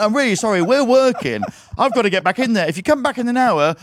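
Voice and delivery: high, pressed apology; harried excuse. Retold or imitated. Host getting rid of a neighbour mid-match, harried excuse. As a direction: plead your excuse, pitched high and loud.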